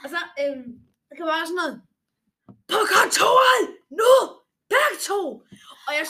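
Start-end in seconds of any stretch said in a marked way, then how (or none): 2.7-5.2 much higher, much louder furious yell; retold teacher shout. Teacher ordering two pupils to his office, shouting. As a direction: bellow the order down the corridor.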